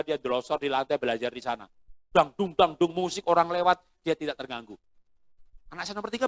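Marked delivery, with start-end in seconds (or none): none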